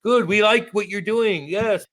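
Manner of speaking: sweetly butter up the protesters; a put-on happy host voice; mock cheery welcome. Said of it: "Like, be happy".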